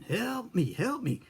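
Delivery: high-pitched mock cry for help; retold scream for help. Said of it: "I started screaming".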